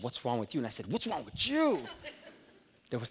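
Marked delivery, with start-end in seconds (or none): 0.9-1.9 higher, laughing edge on the comeback; loaded retort. Retold or imitated. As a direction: fire it back with a loaded laugh. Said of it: "that was loaded".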